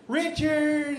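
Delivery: loud high call of Bobby; teacher's roll call. Retold or imitated. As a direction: call the name across the classroom. Teacher calling kids to groups.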